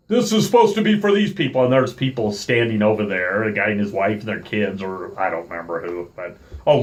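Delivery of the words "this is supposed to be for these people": higher, fast brisk official voice; curt worker. Retold or imitated. Park worker pointing at the waiting family, brisk and high. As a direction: rattle it off impatiently.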